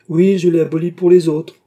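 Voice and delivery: high, loud, smiling exclamation; smiling retort. A narrator's reading. King joking about abolished torture, smiling and loud. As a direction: proclaim it loudly with a smile. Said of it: "fit le roi avec un sourire".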